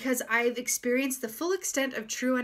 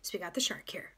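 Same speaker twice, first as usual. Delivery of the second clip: whisper; 4 semitones lower, 7 dB quieter than usual. Real, not acted. Aside while drawing a card.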